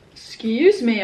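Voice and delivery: talking through hiccups; hiccuping. Real, not acted. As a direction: hiccups breaking into words. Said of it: "I keep hiccuping today".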